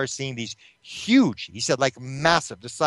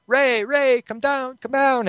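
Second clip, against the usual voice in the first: imitated high-pitched call to come downstairs; imitating a wife yelling for her husband; mock yelling; 11 semitones higher, slower than usual. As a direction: call out high, only half shouting. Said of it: "she's yelling".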